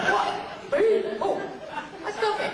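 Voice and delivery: high mock-innocent outrage, pitched well up; imitating Tim Russ. Co-star mocking the captain: high, mock-offended. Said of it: "this is my imitation of Tim Russ".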